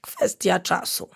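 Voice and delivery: plain reading voice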